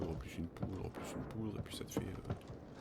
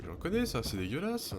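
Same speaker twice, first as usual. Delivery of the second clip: high, loud disgusted outburst; 9 semitones higher, 8 dB louder than usual. Real, not acted. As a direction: recoil in disgust. Disgusted reaction to game.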